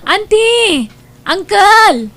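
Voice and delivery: high, loud calls; calling out. Acted. Niece at the gate, loudly calling for auntie and uncle. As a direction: shout each name to be heard inside.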